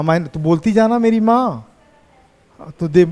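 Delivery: ordinary delivery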